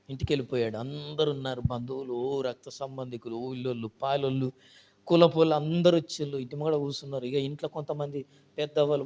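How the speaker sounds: ordinary talk, nothing marked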